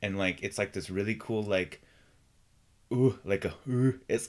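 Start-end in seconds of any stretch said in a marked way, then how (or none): 2.9-4.0 weird vibe. Retold or imitated. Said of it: "that really weird vibe".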